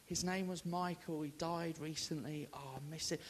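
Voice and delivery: lightly voiced sad daughter; understated tearful softness; grieving confession. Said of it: "She said".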